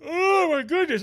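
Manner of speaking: big tired yawn while talking. Real, not acted. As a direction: yawn through the words. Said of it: "I apologize for yawning so much".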